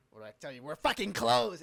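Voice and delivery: yelled, furious shopkeeper; angry shout. Acted. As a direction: bellow it through the door. Shop owner yelling through door.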